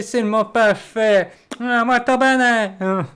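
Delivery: mock English accent. Still joking about his English accent, slow and hammed-up. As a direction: stretch it out in a playful fake English accent. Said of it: "Mon accent anglais".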